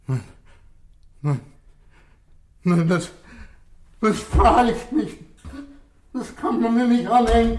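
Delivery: loud, high panicked denial; desperate shout. Acted. Suspect shouting his innocence at the inspector. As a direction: cry out that it wasn't you.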